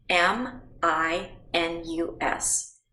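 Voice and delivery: slow, separate letters; slow spelling. Shown as a demonstration. Teacher dictating letters slowly to the class. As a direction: lay each letter out one at a time for the kids. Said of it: "I'm gonna throw letters".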